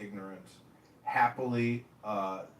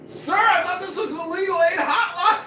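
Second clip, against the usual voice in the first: high, loud words through laughter; laughing disbelief; 11 dB louder than usual. Retold or imitated. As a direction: laugh it out in shock. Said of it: "I was like".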